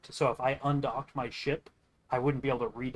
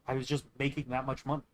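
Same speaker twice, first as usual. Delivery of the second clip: ordinary talk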